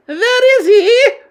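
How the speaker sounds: very high, loud, stretched demand; snapping witch. A narrator's reading. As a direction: screech the question in irritation. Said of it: "snapped the Grand High Witch".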